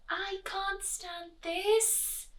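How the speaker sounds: high, slow, strained protest; exasperated bear. A narrator's reading. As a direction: stretch out his despair. Bear fed up in the garden.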